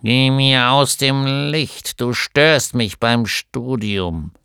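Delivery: langsam, lauter, mürrischer alter Gnom; griesgrämig. A narrator's reading. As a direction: scheuch sie grantig aus dem Licht. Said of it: "sagte das Männchen griesgrämig". (Gnom weist seine Frau ab, langsam und griesgrämig)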